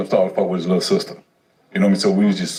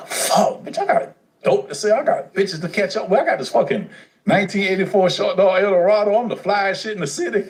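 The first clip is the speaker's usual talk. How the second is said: higher, boastful bragging; cocky inner voice; 9 semitones higher than usual. Retold or imitated. Teen boasting to himself about his car, cocky and high. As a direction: brag like the flyest kid around. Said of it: "You're like".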